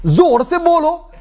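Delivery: high, strained mock scolding; drawn-out, high-pitched exhortation; having a go. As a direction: goad the crowd in imitation of the preacher. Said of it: "the guy had a go at them".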